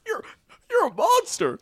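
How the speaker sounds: high-pitched squeaky cricket character; Ethical Bug. Acted. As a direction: squeal it in a tiny cartoon bug voice. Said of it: "fucked up Jiminy Cricket from Puss in Boots".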